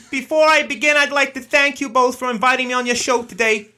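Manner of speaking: high, brash American host voice; put-on American guest voice; American accent. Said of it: "Right, Larry, it's all you".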